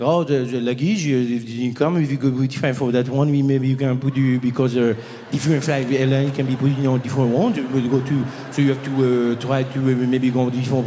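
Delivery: put-on 1 French accent. French info clerk explains.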